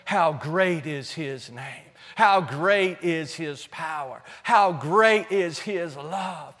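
slow, low, hushed awe; soft, measured adoration; reverent praise. Real, not acted. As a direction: linger on each phrase in worship. Slow reverent praise after quiet appeal.

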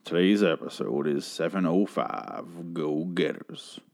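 low, slow, drawn-out intro voice; slow put-on announcer. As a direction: stretch every word out, low and slow. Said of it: "talk like that".

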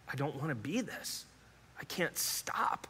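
softly admitting helplessness to God; broken whisper. Retold or imitated. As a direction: let the voice fall to a whisper, defeated. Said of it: "I said".

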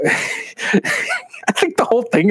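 slow words broken by real laughter; laughing through it. Real, not acted. Cracking up to his co-host over the spam emails, laughing. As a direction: laugh while getting the line out.

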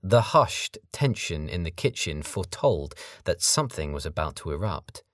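plain reading voice

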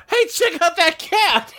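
high, drawn-out call; exaggerated pointing-out shout; mock excitement. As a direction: call it out high and slow, hammed up. Voicing the gang spotting a cat.

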